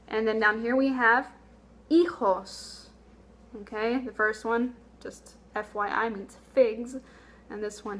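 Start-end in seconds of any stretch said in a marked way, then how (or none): none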